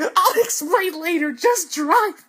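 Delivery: high, loud, desperate re-enacted plea; frantic pleading. Teller begging Ty's mom to drive, frantic. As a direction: beg at a shriek, stretching out "please".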